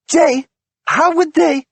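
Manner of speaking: high, loud pressing demand. A narrator's reading. Wife presses husband for answer, urgent.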